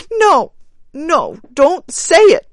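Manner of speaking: high, loud wild cry; crying wildly. Acted. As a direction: cry out in frantic denial. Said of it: "cried the woman wildly".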